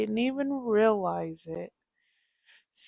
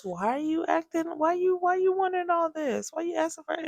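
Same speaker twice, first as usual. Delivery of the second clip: mock baffled husband; 8 semitones higher, faster than usual. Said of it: "he goes".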